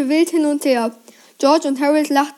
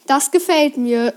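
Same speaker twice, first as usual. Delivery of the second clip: plain reading voice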